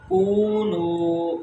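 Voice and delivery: کونو slow, both oo held; long vowels. Shown as a demonstration. Teacher drills wao madda, کونو drawn out. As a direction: stretch each vowel of کونو. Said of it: "کاف کو لمبا کریں گے".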